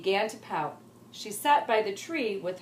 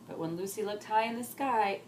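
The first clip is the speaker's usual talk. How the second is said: ordinary delivery, nothing marked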